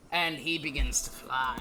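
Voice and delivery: usual speaking voice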